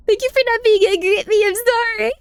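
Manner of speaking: squeaky high apology; high cute voice. Real, not acted. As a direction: say it sweetly and high.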